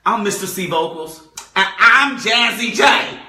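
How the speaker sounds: loud, rising shouted name; announcer-style big entrance; hype show intro. Shown as a demonstration. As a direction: bring the energy on the name. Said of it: "This is how we should have said it".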